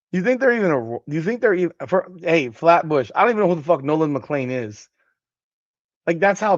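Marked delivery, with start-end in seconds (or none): none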